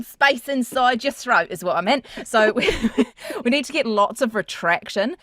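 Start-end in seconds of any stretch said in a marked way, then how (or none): none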